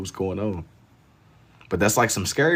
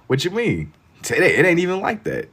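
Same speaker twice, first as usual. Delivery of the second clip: much higher, insistent denial; high protest; 10 semitones higher, 4 dB louder than usual. Real, not acted.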